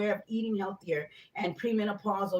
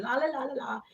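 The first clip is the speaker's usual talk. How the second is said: light, higher la-la-las; soft and dainty; 3 semitones higher, 3 dB quieter than usual. Retold or imitated. Contrasting herself with the pushy caller, dainty la-las. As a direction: trill it softly, daintily. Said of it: "here I am feeling soft and dainty".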